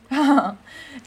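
high loud villain cackle; evil laugh. Acted. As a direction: cackle like a cartoon baddie. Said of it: "con una sonrisa malvada".

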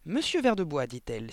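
a touch of lost patience; losing patience. A narrator's reading. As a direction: call him to order. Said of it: "commençait à perdre patience".

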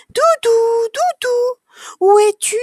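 put-on very high, loud little-child voice; anxious child calling. A narrator's reading. Child searching the box for Doudou, high worried call. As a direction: call out for a lost toy, pitch way up.

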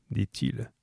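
ordinary delivery, nothing marked

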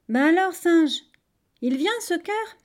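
high, loud nagging question; fed-up prodding, pitch climbing; impatient call. A narrator's reading. Tired crocodile calls up the tree.

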